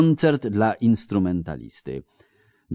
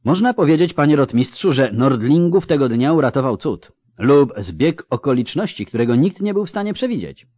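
lightly voiced higher-pitched exam answer; cadet reciting; 6 semitones higher, 4 dB louder than usual. Cadet answering the captain on the battle, lightly voiced recitation. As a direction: recite it to the examining officer.

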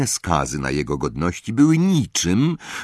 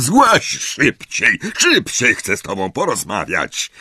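angry uncle; 6 semitones higher than usual. A narrator's reading. Uncle yelling at Harry to come down. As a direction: hurry him down with a bark.

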